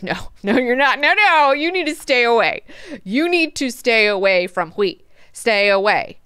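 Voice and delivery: loud, insistent acted warning; hammed-up stern refusal; emphatic forbidding. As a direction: forbid it firmly, voice jumping up. Acted forbidding of Duncan by Leto.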